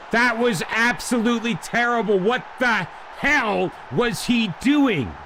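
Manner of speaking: high, indignant rant at the player; exasperated outburst. Real, not acted. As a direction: let the frustration boil over. Fuming at a bad play.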